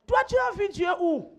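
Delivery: high, loud challenge to the congregation; challenging. Real, not acted.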